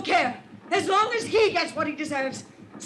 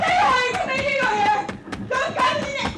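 high frantic cry of refusal; desperate shout; 10 semitones higher than usual. Acted. Woman fleeing the investigator, shouting in panic. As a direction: scream it back over your shoulder.

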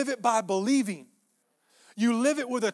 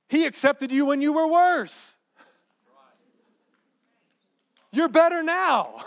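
loud, high, emphatic proclamation; raised preacher's cry, pushed hard; preaching shout; 7 semitones higher, 7 dB louder than usual. Real, not acted. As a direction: belt it out to the congregation. Preacher exclaims to congregation.

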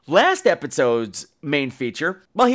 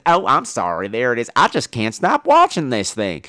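put-on southern accent; 5 semitones lower than usual. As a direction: put the southern twang back in. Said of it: "southern accent".

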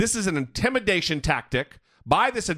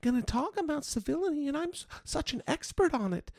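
much higher, hushed, self-satisfied parody; mock prim expert; 10 semitones higher, 8 dB quieter than usual. Host parodying the spokeswoman on civility, high and hushed. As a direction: sneer at her civility lecture in a tiny voice. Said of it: "that's what she's doing here".

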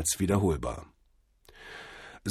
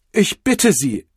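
raised, pained plea; gequältes Flehen; 11 semitones higher, 8 dB louder, slower than usual. A narrator's reading. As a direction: beg him loudly, voice high with strain. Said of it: "rief der gequälte Butler". (gequält und laut flehend)

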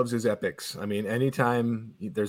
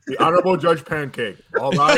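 loud, higher bailiff announcement; mock-solemn bailiff proclaiming; courtroom bailiff; 4 semitones higher, 6 dB louder than usual. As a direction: call the court to order. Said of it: "Imagine being that bailiff".

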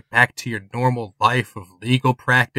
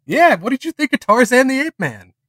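cheerful co-host; 11 semitones higher, 5 dB louder than usual. Acted. GM voicing co-host.

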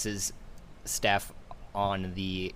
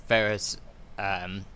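normal talking voice

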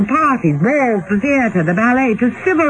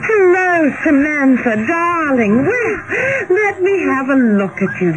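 delighted greeting; 8 semitones higher than usual. Acted. Sisters reunite, Sophia greets Samantha.

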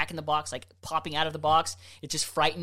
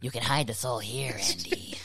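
creepy doll voice; 6 semitones lower, 5 dB quieter than usual. Acted. Creepy Chucky-style offer.

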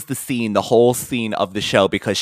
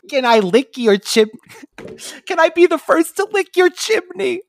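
quoting Urkel. Retold or imitated. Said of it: "this is the line".

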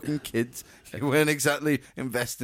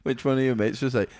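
ordinary delivery

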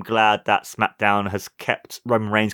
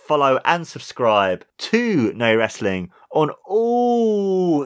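announcer outro; 5 semitones higher than usual. Acted. Show outro announcer read.

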